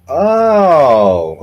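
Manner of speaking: mock realization. Retelling talent scouts recognizing him, mock realization. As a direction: imitate someone suddenly catching on. Acting out their moment of realizing.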